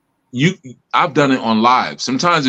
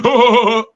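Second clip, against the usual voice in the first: sudden high laugh; 13 semitones higher, 4 dB louder than usual. Retold or imitated. Mocking siblings' laughter.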